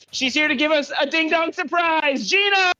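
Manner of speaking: high, rising show-host build to the name; game-show style guest reveal; announcer introduction. Acted. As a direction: call the guest out high and big.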